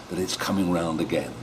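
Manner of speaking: ordinary delivery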